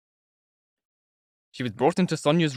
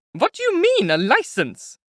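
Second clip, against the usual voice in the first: high, loud, wailing protest; 6 dB louder, slower than usual. A narrator's reading. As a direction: cry out in disbelief, voice climbing high. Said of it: "Katerina Ivanovna wailed".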